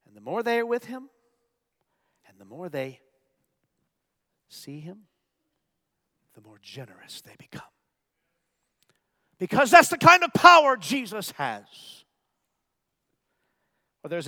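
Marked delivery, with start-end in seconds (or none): 0.1-7.6 fading to a hush. Real, not acted. Hushed build before a loud burst. 9.4-11.6 loud, high proclamation; forceful, emphatic declaration; sudden preaching burst. Real, not acted. Sudden burst after the hush.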